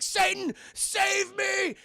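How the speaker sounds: very high, loud, begging cry; desperate plea. Retold or imitated. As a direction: shriek for help in terror. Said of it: "Saddam Hussein's like".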